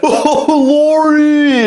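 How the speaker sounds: high, drawn-out wailing cry with a laugh in it; mock sob. Mocking an actor's sudden teary accent slip, wailing. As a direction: ham up a broken sob over Laurie. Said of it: "see it when they cry".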